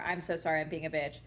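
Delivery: ordinary talk, nothing marked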